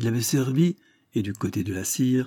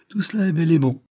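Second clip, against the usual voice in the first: higher, louder narrated exclamation; exclaimed; 8 semitones higher, 5 dB louder than usual. A narrator's reading. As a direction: exclaim it on a raised pitch. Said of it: "s'écria-t-il". (exclamation vive, voix plus haute)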